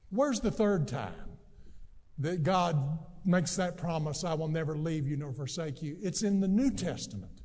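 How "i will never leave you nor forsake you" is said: rattled off fast and low as a reminder; quick throwaway recap. Shown as a demonstration. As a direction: toss the verse off quickly, almost under the breath. Quick quoted recap of promise.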